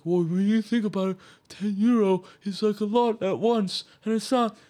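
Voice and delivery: mocking impression. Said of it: "you're a fucking idiot if you're like".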